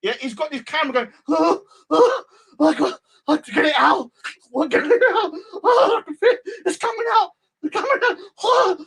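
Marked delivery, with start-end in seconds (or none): 1.3-8.8 high, strained mock wailing with laughs; pitched-up hysterical mock cries, laughing through them; mock panicked exorcism wail. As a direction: ham up a man screaming a ghost out.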